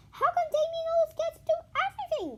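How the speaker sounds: fast, high, loud whine; whiny fast classroom gripe; griping. Acted. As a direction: complain as a jealous kid. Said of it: "gripped Cassie".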